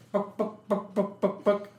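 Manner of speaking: high clucking chicken noises. Retold or imitated. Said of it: "the chicken comes back".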